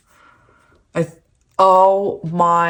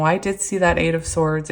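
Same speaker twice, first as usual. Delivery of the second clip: ordinary talk, nothing marked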